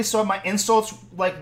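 ordinary talk

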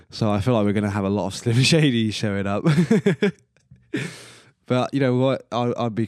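laughing through the words; chuckling while talking. Real, not acted. Laughing at own [name] joke.

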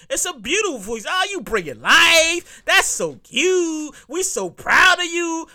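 drawn-out, mock-proud cooing; mock gushing. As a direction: gush insincerely, stretching the last words. Imitating gushing praise for new mothers.

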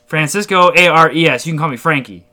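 put-on chummy voice, emphatic spelling; Frankie. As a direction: imitate a pushy guy repeating his name. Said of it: "And then he was like".

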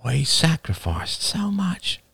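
soft, slow, high-pitched lament; softly spoken. A narrator's reading. Man gesturing into the night, softly. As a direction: say it softly and slowly. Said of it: "said another man softly".

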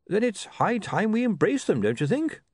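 a doddering academic spelling his name; fussy old wizard. Acted. Bursar speaks.

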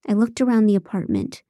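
ordinary delivery, nothing marked

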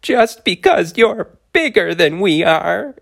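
high tiny indignant complaint. Acted. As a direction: whine it like a wronged little creature. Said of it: "shaking with emotion".

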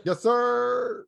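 high-pitched, drawn-out "yes, sir"; high playful hype; eager agreement. Real, not acted. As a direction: squeal it out with playful enthusiasm.

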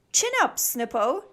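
chirpy. A narrator's reading. Max cheering up Snip, chirpy. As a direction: chirp it encouragingly. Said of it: "chirped Max".

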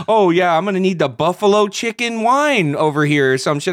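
loud, high sarcastic put-on; sarcastic mock order. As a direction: sneer it like a silly customer. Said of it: "It's like".

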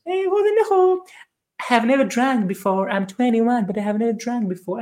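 high-pitched put-on innocent voice; mock innocent protest. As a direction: plead innocence in a squeaky mock voice. Acts out a youngster denying drinking.

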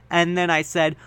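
ordinary delivery

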